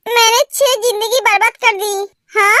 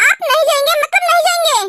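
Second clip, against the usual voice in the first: higher-pitched, emphatic refusal without real shouting; mildly raised voice, adamant; insistent loud refusal; 4 semitones higher than usual. Acted. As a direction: put your foot down, voice pitched up. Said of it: "ते बहुत जोर से बोलती है".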